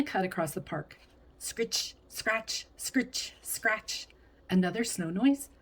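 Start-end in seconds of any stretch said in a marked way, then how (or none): 1.4-4.1 scratchy sound effect. A narrator's reading. Ski sound effect words.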